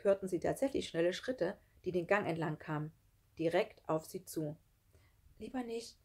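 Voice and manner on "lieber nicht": small, soft, raised voice; quick reply. A narrator's reading. Sarah gives in to Schlunz, quiet quick answer. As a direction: answer softly, backing down. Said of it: "sagte Sarah schnell".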